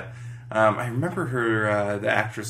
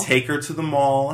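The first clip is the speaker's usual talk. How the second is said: usual speaking voice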